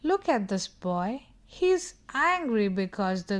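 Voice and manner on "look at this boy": plain reading voice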